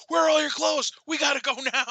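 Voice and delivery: high, loud panicked shout; frantic shout. Retold or imitated. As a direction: yell like you're running late. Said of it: "I was like".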